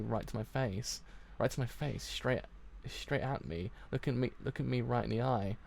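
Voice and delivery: ordinary delivery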